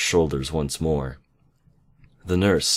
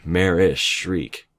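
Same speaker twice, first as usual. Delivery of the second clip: ordinary delivery, nothing marked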